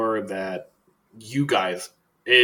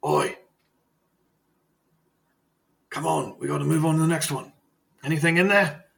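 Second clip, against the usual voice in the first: higher-pitched voice calling out in character; put-on voice, pitched up; guard's voice; 6 semitones higher than usual. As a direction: play a henchman hurrying the search along. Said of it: "one of the other people go".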